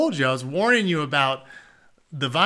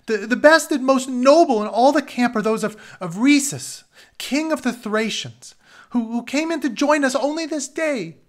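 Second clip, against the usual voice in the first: frightened stammer; 10 semitones higher, 8 dB louder than usual. Acted. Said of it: "Hoping to save his neck".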